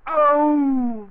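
high, loud cry of pain. Acted. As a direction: yelp as the lamp hits. Struck on the head with lamp.

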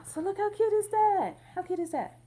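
high, loud delighted squeal over the quilt; excited gushing. Real, not acted. Showing the viewers her new quilt, excited and gushing. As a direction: gush like you can't contain it.

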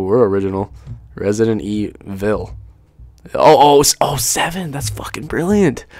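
normal talking voice

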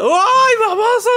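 very high, loud mock wail; alarmed shout. Bearer to partner as it falls, shrieked shout. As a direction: shriek it out as it all topples.

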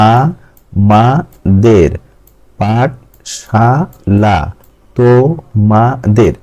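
আ-মা-দের, পাঠশালা, তোমাদের each in three slow, separate beats; syllable by syllable. Shown as a demonstration. Teacher showing trisyllables, আ-মা-দের, পাঠশালা, তোমাদের slowly broken in three. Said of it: "সুন্দর করে আলাদা আলাদা দেখাচ্ছি".

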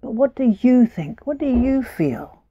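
a small mouse coaxing the truth out; gentle mouse voice. Acted. Mouse gently questions Mika.

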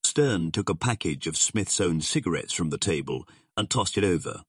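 ordinary delivery

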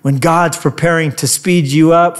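ordinary talk

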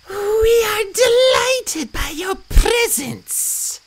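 very high, slow cat-goddess hiss; loud hiss. A narrator's reading. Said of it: "Bastet hissed loudly".

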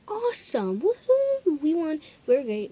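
high, loud victory whoop; excited cheer. Retold or imitated. Girl reliving the volleyball win, cheering loudly. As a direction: cheer like you just won.

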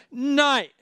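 højt, imiteret afbrydende råb; råb nej som manden i baggrunden; råbt afvisning. Retold or imitated. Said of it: "og han råbte". (genfortalt råb med højere stemme)

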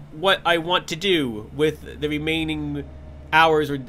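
ordinary talk

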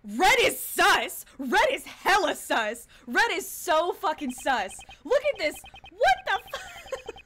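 loud, drawn-out accusing yells; mock outraged shouting. Streamer reacting to new red-clad character, mock outraged shout.

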